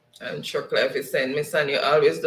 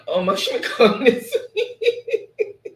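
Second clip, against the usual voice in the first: slow, laughing read-through of the comment; laughing so hard the words stretch out; laughing while reading; 9 semitones higher, 6 dB louder, much slower than usual. Real, not acted. Reading a funny chat comment.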